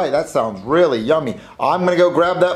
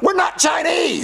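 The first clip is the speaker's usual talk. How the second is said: exasperated shout; 12 semitones higher than usual. Acted. Denying again, shouting.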